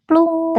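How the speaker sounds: high playful plunk noise; splash sound effect. Pretend. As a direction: mimic the bait hitting water. Imitating bait splash.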